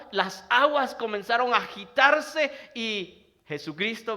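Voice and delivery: impassioned Spanish sermon; fervent preaching. Acted. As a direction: preach it out, loud and driving. Preaching the story loud.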